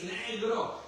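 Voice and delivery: ordinary delivery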